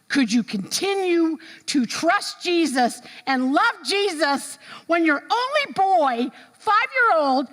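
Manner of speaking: high, slow disbelief; high, pained incredulous question; incredulous friends. Retold or imitated. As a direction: demand an answer, aghast. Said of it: "I have friends that said".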